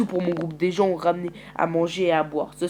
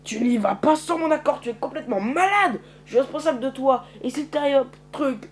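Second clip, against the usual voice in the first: scolding rant; 7 semitones higher, 3 dB louder than usual. Acted. Scolding Island.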